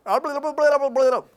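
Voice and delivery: high, loud gibberish babble; sailor babbling. Retold or imitated. Dream sailor answering with nonsense, high, loud babble. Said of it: "this guy looks at me and he goes".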